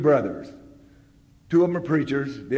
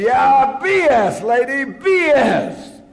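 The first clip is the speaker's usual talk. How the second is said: high, loud heckling yell; angry shout; 13 semitones higher, 11 dB louder, slower than usual. Retold or imitated. Heckling Sue at the meeting, angry shout. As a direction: jab each word at her. Stood and pointed at her.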